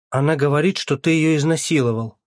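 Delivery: high, loud distressed outcry; crying out. A narrator's reading. Mother confronting her son with the accusation, crying out. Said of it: "закричала Ирина Фёдоровна".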